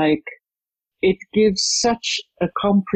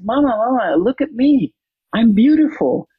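put-on higher, excited little girl calling her mama; a girl's higher, eager call for her mother's attention; 7 semitones higher than usual. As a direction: show off your new dress to Mama like a proud six-year-old. Child voice in retold story.

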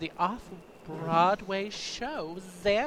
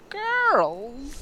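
put-on drawn-out campy "Girls"; 7 semitones higher than usual. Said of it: "I still do that weird thing".

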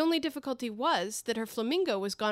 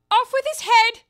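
much higher, much louder shrieked command; Queen's shout; 14 semitones higher, 15 dB louder than usual. Acted. The Queen ordering the cat's beheading, shrieking. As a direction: bellow the order without even looking round. The Queen settles all difficulties.

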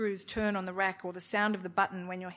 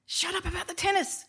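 much higher sharp wife voice; wife snapping; 11 semitones higher, 4 dB louder than usual. A narrator's reading. Wife snapping at her husband, high and sharp. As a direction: bite it off like an irritated wife. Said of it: "she said".

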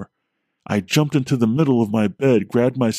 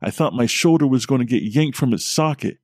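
ordinary delivery

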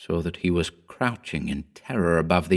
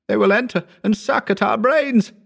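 moaning. A narrator's reading. Howard continues moaning.

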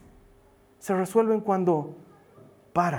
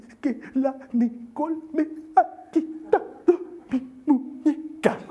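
put-on higher, slow little girl's voice, still sniffling; 5 semitones higher, slower than usual. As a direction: speak like a small calmed-down daughter. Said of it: "se calma y me dice".

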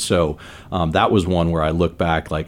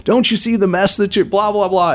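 high-pitched mock scolding yell; grandma yelling. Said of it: "she starts yelling at me".

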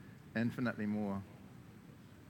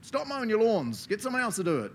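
high, loud call across the yard; mock loud God command; 8 semitones higher, 6 dB louder than usual. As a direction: bark it like a boss yelling over the fence. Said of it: "Why doesn't he just go".

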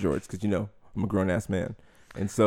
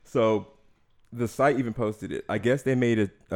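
normal talking voice

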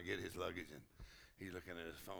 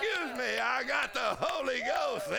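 higher, proud, unashamed excuse me; excited apology; 10 semitones higher, 4 dB louder than usual. Retold or imitated. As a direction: announce it with joyful pride. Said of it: "you go".

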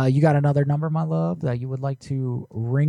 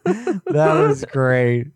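laughing; 6 semitones higher, slower than usual. Real, not acted. Laughing after the prank call.

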